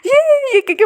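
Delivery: squealing "ये, ये" high with delight; joyful cheering. Acted. Partner cheering wildly over the pregnancy news, thrilled squeals. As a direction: cheer like you just got great news. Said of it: "वो नाचने लगे".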